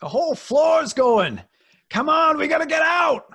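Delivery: high, loud terrified yell; panicked shout. Acted. Pete yells at the others as the floor collapses, panicked shout. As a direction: scream at them to run.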